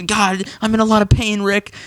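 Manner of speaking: high whiny pained Morty; mock pained Morty whine. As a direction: whimper it up high. Retelling Morty in pain.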